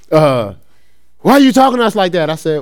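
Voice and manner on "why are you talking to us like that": high, loud, rapid mock protest; protesting kids. Kids protesting to their corrections worker, imitated in high voice. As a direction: imitate kids objecting in a rush. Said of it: "the kids would be like".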